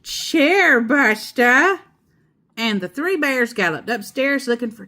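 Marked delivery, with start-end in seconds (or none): 0.0-1.8 slow, raised bear roar. A narrator's reading. Riled grizzly accuses the intruder, slow roar. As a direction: drag it out as a riled bear's roar. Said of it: "he roared".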